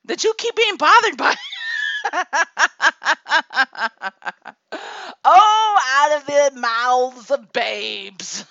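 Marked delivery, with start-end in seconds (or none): 1.4-5.2 loud burst of rising laughter; shouting laugh. Real, not acted. Big laugh at daughter's comeback. 5.2-8.5 loud oh, then slow laughing words; delighted slow laughing aside; laughing through it. Real, not acted. Reacts to daughter's remark.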